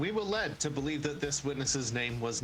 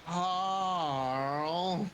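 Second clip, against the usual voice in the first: drawn-out call; 5 semitones higher, 7 dB louder than usual. Acted. Everyone calls out Carl.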